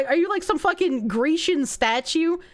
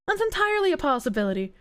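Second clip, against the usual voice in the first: quick throwaway; much faster than usual. Shown as a demonstration. To chat, repeating the point briskly. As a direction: rattle off "That's entirely a possibility" fast.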